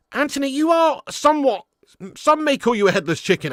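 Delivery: exasperated yell. Real, not acted. Fan berates winger.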